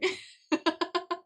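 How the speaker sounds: big high burst of laughter; loud high laugh. Real, not acted. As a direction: crack up loudly. Laughs at the chat.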